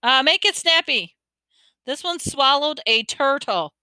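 calling out. A narrator's reading. Attendant hurrying the driver along, calling out. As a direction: project it, stretching the last part. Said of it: "the attendant called to the driver".

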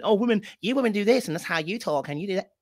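put-on rant losing steam; disrespectful rah-rah. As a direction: mimic someone lecturing women. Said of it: "you start disrespecting the person right in front".

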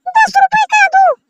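very high, slow squeaky toy voice; Superman toy voice. Pretend. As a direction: squeak the price like the little Superman shopkeeper. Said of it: "कितने का है Superman भैया?". (Superman खिलौने की बहुत ऊँची पतली आवाज़)

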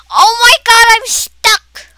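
high loud panicked yell; yelling that the car is stuck; panicked shout. Real, not acted. Kid yells as game car gets stuck.

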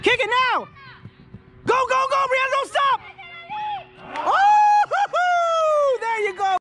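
high urgent cheering on; excited cheering. Real, not acted. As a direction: urge her on, high and fast. Urging player on from sidelines.

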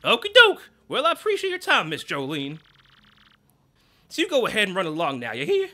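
over-the-top jolly boss; Grubba's voice. Acted. Boss shooing his manager away, jolly.

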